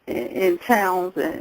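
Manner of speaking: ordinary talk, nothing marked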